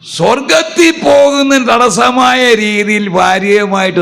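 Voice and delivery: slow pulpit exhortation; preaching. Real, not acted. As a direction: drive the warning home slowly.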